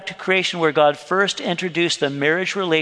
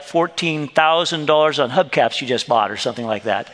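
ordinary delivery

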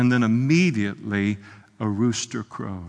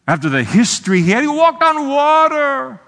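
very high, loud, anguished preaching shout; impassioned outcry; 13 semitones higher, 9 dB louder than usual. Acted. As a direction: cry out at full force, incredulous. Incredulous cry over Peter's denial.

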